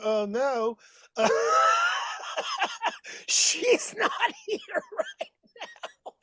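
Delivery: high falsetto coy woman, slow and giggly; mock lady voice. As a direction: play the girl who isn't home, laughing. Pretends the wig lady is absent.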